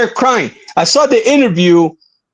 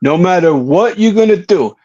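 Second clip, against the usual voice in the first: normal talking voice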